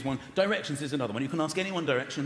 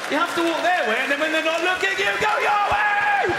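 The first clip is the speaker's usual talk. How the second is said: building to a yell; 11 semitones higher, 6 dB louder than usual. Acted. Ranting about wrong directions, building to a triumphant yell. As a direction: build exasperation to a long, high yell. Climax of exasperated rant.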